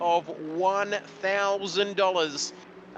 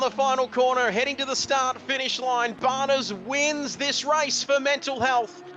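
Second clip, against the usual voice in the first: rising, driving race call as the cars head for the line; live race play-by-play; 4 semitones higher than usual. Acted. Commentator calling the final corners to viewers, building excitement. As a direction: call the last corners as they happen.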